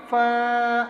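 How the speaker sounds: فا with a long, held aa; drawn-out vowel. Shown as a demonstration. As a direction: stretch the aa of فا steadily. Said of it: "کھینچ کر پڑھتے ہیں". (فا کو کھینچ کر)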